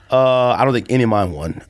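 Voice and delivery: normal talking voice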